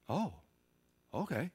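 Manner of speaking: ordinary delivery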